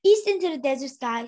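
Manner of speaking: plain reading voice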